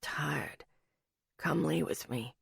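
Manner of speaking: low, very soft drowsy man; sleepy man. A narrator's reading. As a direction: murmur it, nearly asleep.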